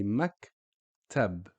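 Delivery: "maktab" slowly, in two parts, a pause after mak; stop on the K. Shown as a demonstration. Teacher showing where we stop, slow split syllables. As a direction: hold back after the K in "maktab", adding no vowel. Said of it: "we stop on the K sound".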